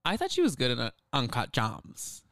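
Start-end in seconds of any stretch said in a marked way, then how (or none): 1.1-2.2 slow, drawn-out "Gems"; imitated viral pronunciation. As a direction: stretch "Gems" in mimicry of the catchphrase.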